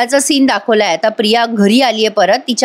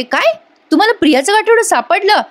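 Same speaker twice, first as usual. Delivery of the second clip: surprised; 9 semitones higher than usual. Acted. Sayli hears the bundle was found.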